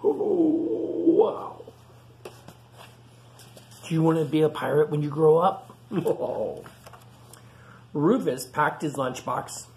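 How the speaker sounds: ordinary delivery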